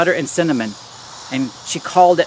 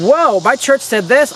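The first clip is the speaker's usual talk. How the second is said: higher-pitched acted "whoa" of discovery; amazed realization; 6 semitones higher, 3 dB louder than usual. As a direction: play suddenly struck by a doubt. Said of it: "That's what you should be constantly doing".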